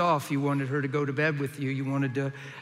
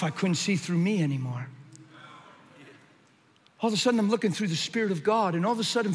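ordinary delivery

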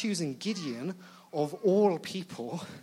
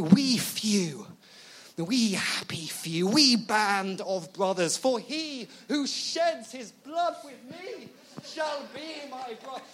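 slow, hammed-up Shakespearean oratory, swelling higher; rousing battle speech; 5 semitones higher, 3 dB louder than usual. General inspiring courage in his men, rousing speech. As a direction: declaim it slowly to rally the troops, climbing in pitch. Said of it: "the general is meant to do this rousing speech".

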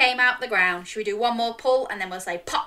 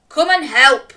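loud call across the field; 9 dB louder than usual. A narrator's reading. Father calling his son from the field, loud call. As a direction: call Jack over from the tree. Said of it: "called his father".

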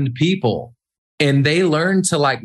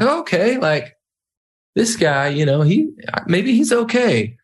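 relieved reaction; 7 semitones higher than usual. Retold or imitated. Imagined reaction of loosened listeners.

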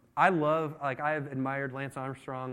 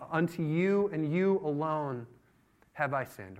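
slow, deliberate, falling away at the end; measured confession; slower than usual. Acted. David confessing to the Lord, measured and slow. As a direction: weigh each word without raising the voice.

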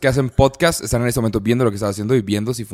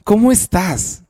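podcast announcer intro; 9 semitones higher, 7 dB louder than usual. Acted. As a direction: belt out the show opening like a ring announcer. Abre el programa como presentador. (presentador a todo pulmón, agudo y alargado)

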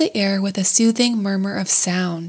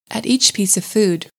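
plain reading voice

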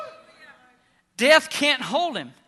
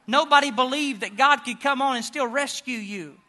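ordinary talk

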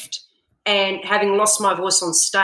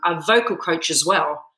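ordinary talk, nothing marked

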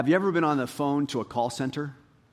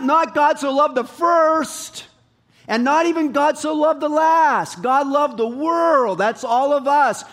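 preaching at full force; 11 semitones higher, 9 dB louder than usual. Acted. Preacher proclaiming God's love to the congregation, loud and high. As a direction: drive every word of the verse home.

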